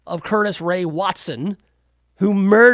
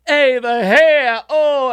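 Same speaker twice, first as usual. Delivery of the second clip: slow, louder, higher hammed-up Italian-American accent; mock Italian guy; 6 semitones higher, 5 dB louder, much slower than usual. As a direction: strut like a wiseguy fussing over his hair. Mocking Italian tough-guy stereotype.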